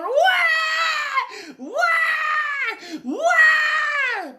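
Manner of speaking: loud, high-pitched imitation baby cry; long mock newborn wails; imitated infant wail. Infant balled up on their back, imitated wailing. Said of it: "a infant balled up on their back going".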